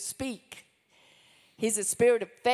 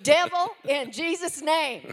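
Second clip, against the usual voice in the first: higher-pitched, commanding prayer; forceful prayer declaration; 7 semitones higher, 5 dB louder than usual. Real, not acted. Woman praying against the devil, forceful declaration. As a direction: address the devil with firm authority.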